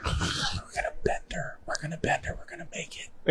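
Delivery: breathless whispered worry. Real, not acted. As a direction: whisper it, tense before the race. Nervous about the race.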